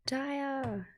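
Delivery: high, drawn-out call of a name; acted high-pitched hail. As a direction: sing the name out high to get attention. Acting a character calling out to Daya.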